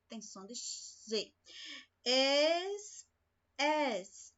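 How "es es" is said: "Es" said slowly as a drawn-out hissing S, twice; isolated sound. Shown as a demonstration. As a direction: stretch the "Es" into a clear S sound. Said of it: "Ó, podia ser um S aqui". (destacar o som "Es" como um S sibilante)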